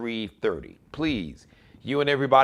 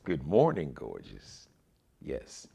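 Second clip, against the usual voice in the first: gentle self-affirmation; 12 semitones lower, 7 dB quieter than usual. Shown as a demonstration. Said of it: "Say that to yourself three times".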